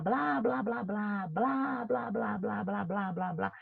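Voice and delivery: a lightly sing-song blá-blá babble; mock chatter. Teacher imitating people's endless chatter for children, playful sing-song. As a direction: make it sound like chattering crowds.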